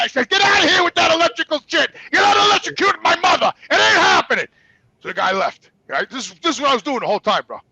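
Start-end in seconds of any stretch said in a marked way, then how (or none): 0.3-4.5 high, strained angry shout; furious refusal. Retold or imitated. Son refusing the test on his mother, shouting angrily. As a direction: yell them out of the room. Acted quarrel with the test technician.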